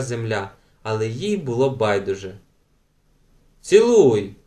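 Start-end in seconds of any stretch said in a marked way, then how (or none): 3.6-4.4 higher, louder order; commanding. A narrator's reading. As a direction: call out the order sharply. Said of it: "звеліли в один голос".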